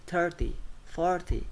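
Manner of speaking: THIRty and FORty, first syllable high; stress on first syllable. Shown as a demonstration. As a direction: punch the front of thirty and forty. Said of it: "have stress on the first".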